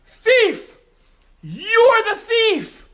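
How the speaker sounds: piercing, very high scream; screaming accusation. Acted. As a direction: scream "Thief!" and point.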